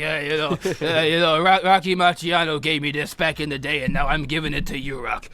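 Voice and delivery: low, lightly voiced old mentor; a hint of a gruff old trainer. Mick handing Rocky the gloves, lightly voiced low mentor. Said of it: "But he's like".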